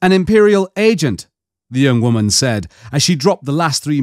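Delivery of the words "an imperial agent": higher, louder, crisp woman's voice; female agent. Acted. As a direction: state your rank with authority. Mara identifies herself, voiced female.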